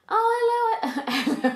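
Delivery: high mock-British greeting; put-on British accent. Demonstrating her greeting to the band, put-on British accent. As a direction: greet them like a fake Brit. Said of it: "had a conversation in British".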